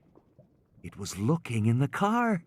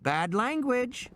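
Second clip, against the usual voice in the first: put-on high, slow, prim child's reproach; little girl scolding; 12 semitones higher, slower than usual. Lex tells off Ed Regis for swearing, prim child. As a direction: wag a finger with your voice. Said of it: "Lex said, wagging her finger".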